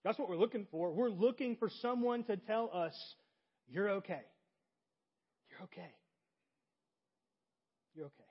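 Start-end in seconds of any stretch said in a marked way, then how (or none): 3.7-8.2 hushed, tender and unhurried. Acted. Preacher voices the longed-for reassurance.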